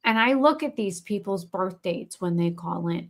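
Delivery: ordinary talk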